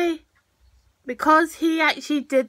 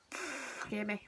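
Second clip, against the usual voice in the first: normal talking voice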